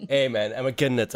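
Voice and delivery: normal talking voice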